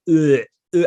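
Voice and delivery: loud disgusted retch; imitated gagging.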